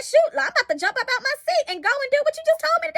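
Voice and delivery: high, fast, laughing fluster; flustered rush. Retold or imitated. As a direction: play it jumpy, half laughing at herself. Retelling her startled reaction.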